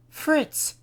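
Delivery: high, loud calling out; cry out. A narrator's reading. Wife Nora crying out to her husband in alarm. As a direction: call his name in alarm. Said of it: "Nora cried".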